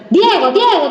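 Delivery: sharp, high-pitched scolding of a name; hard tone. Acted. Parent constantly calling out a child's name, hard tone. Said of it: "con este tono duro".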